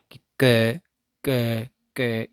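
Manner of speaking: क sounded crisply, tongue back hitting the palate; k sound demo. Shown as a demonstration. Teacher models the velar क for the class, crisp. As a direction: tap out क three times. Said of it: "जैसे आप उच्चारण करके देखना".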